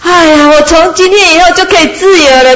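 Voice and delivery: high, joyful call of a freed sheep; happy cry. Acted. The sheep cries out from the rock, high and joyful. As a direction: call out in delight. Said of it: "他就很高兴在那边叫".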